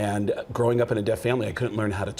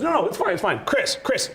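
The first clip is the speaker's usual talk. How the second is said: Richard pushing; 10 semitones higher than usual. Retold or imitated. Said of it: "he goes".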